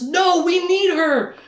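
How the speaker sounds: high, drawn-out slow yell; urgent mock shout. Richard stops wizard attacking Shota, mock desperate shout. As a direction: act out a desperate shouted plea.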